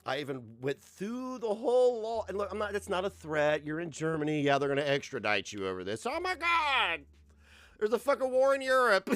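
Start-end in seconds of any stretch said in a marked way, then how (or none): 6.1-9.1 high, loud hammed-up alarm; shrill, exaggerated freak-out; mock panic. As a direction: wail as the fake-terrified victim. Mock panic over extradition threat.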